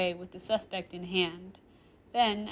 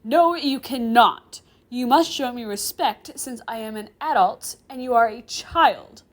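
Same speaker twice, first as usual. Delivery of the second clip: loud, emphatic, raised pitch; demanding; 4 semitones higher, 11 dB louder than usual. Acted. Professor asserting himself over a student, demanding. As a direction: demand respect forcefully. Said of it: "I demand".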